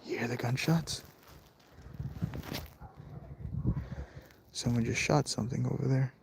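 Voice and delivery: very soft, low and wary; hushed alarm. Real, not acted. Vlogger to viewers after hearing gunshots, hushed and wary. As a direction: keep your voice right down, nearly whispering at first. Hears gunshots outside at night.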